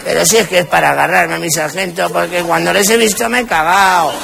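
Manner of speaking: put-on high, slurred drunk begging the sergeant; drunk man. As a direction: ham up a pleading drunk. Drunk keeps talking to sergeant. (borracho pidiendo al sargento)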